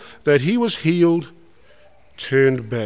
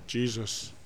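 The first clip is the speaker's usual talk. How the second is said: much softer, lower, breathed name; hushed reverence; 5 semitones lower, 13 dB quieter than usual. Retold or imitated. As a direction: barely say it, overcome. Leper falls at Jesus' feet.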